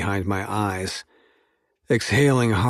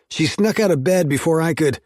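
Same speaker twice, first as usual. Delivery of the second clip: raised voice, words forced out fast; angry; 8 semitones higher, 5 dB louder than usual. Acted. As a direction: snap it out in heated frustration. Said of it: "I growled between clenched teeth".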